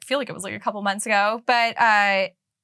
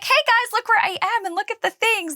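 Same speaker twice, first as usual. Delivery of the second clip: excited vlogger; 13 semitones higher than usual. Retold or imitated. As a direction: hype up the audience. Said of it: "being like".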